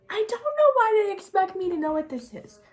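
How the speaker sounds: high, loud exasperated outburst; exasperated complaint. Real, not acted. Vlogger griping about numbered makeup colours, exasperated.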